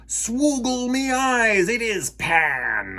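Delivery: high, slow gloating Captain Hook; gloating pirate. Acted. Hook spots Pan on the cloud, gloating slowly. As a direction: savour spotting the enemy. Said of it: "Hook gloated".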